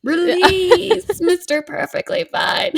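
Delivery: high, slow goblin begging with a laugh. Retold or imitated. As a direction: plead like Gollum with his treasure. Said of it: "perfect impression of two thousand eight Taylor Goblin's voice".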